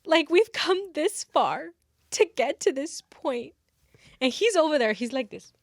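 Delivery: put-on earnest girl. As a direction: play it up as an over-earnest, fearful student. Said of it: "a girl's like".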